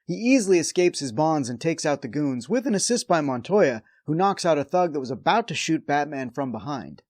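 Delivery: normal talking voice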